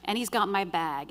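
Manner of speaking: ordinary delivery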